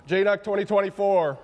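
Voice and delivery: loud cheer. Real, not acted. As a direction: hype up the event name. Cheering the conference name.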